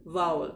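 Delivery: वावल, clearly; correct pronunciation. Shown as a demonstration. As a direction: say वावल as vow-ul. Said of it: "क्या कहते हैं?".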